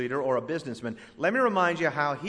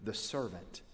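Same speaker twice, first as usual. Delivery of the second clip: humble; 5 semitones lower, 10 dB quieter, slower than usual. Retold or imitated. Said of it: "He says what we all ought to say".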